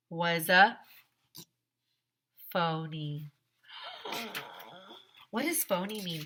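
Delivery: usual speaking voice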